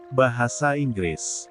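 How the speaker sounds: plain reading voice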